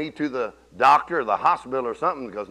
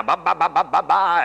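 mock prayer babble. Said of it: "binding and loosening".

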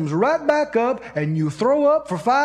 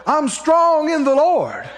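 imitating her strong, high proclamation; loud, bold declaration; 7 semitones higher, 4 dB louder, slower than usual. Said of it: "you could have heard her two blocks away".

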